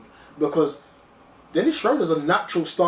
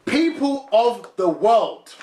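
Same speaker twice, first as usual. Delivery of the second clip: slow, raised announcement to the whole world; higher, drawn-out public address; mock proclamation; 5 semitones higher, 4 dB louder, slower than usual. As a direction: proclaim it grandly, each word spaced out. Mock proclamation correcting a name.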